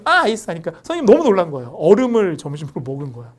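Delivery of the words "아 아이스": "아, 아이스" with the R dropped, higher and louder; mock a student proudly dropping the R in "아이스"; R-less rice. Retelling a student's blunder to the teacher, high and loud. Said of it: "그래서 발음을".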